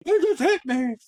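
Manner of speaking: high, loud mock accusation; retold yelling. Girlfriend accusing him after he knocks the knife, high yell. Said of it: "she started yelling".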